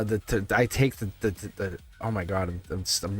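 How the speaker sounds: tripping over her words; stammer, losing the word; stuttering. Real, not acted. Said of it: "I'm stuttering my words".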